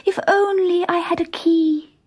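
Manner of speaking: put-on high, young girl's wish; a high girlish wish to herself; wistful girl. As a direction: sigh it like a longing child. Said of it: "she thought".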